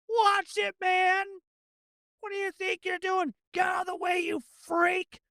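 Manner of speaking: high-pitched angry bystander; indignant yelling. Acted. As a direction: holler at someone shoving past you. Background passersby yelling at a runner.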